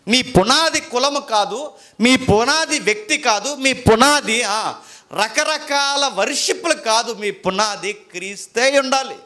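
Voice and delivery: slow, loud, high, each phrase hammered; preacher pounding out his main point; emphatic preaching. Acted. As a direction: drive home every line like nails.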